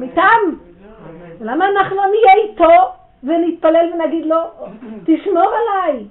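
loud, high, impassioned plea; rising preaching. Real, not acted. Preacher urging the girls to pray, loud and high. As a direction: build to a fervent prayer.

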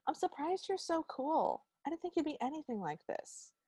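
high, soft imitated suitor; backhanded compliment. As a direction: coo it softly up high, mock-sweet. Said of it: "he's really into left-handed compliments".

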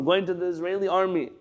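normal talking voice

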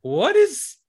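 very high baffled audience question; puzzled squeak. Retold or imitated. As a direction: jump way up, baffled. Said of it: "at first people are like".

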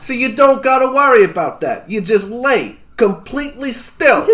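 high, comic New York character voice; New Yorker accent. Acted. Knife-thrower explains how he sleeps, New York accent. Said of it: "this is now my best accent".

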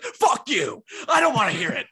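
loud, high acted shout; angry outburst. Snapping at anyone who says hi, angry shout. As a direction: yell it like you've been set off.

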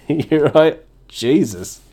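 usual speaking voice

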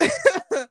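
high, brief burst of laughter; short laugh. Real, not acted. Laughing at her own story of the night out, short laugh. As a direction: let out a quick high giggle.

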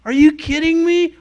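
high, loud stunned outburst; amazed disbelief. Retold or imitated. Shopkeeper floored by a stranger's blessing, shouted disbelief. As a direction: blurt it out in total shock.

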